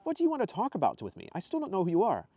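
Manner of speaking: high, puzzled questioning; baffled Ethan. Acted. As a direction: pitch up in bewilderment. Narrator voices baffled Ethan.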